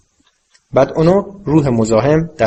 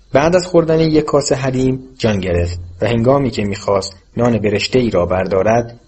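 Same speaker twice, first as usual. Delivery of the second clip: ordinary delivery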